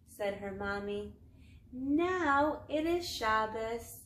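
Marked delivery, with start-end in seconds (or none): 1.7-4.0 slow, louder, delighted; mother proudly telling her child it is finally Shabbos, delighted; joyful announcement. A narrator's reading. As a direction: announce it like a happy reveal.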